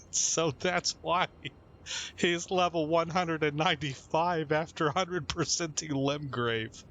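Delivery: words breaking up into giggles, high-pitched; real helpless laughing. Real, not acted. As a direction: fight through uncontrollable laughter to get it out. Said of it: "I'm laughing so hard".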